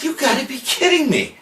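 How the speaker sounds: high hammed-up disbelief; mock outrage. To the manager about the drug test, high mock outrage. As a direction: throw it up high, playing outraged. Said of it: "I said".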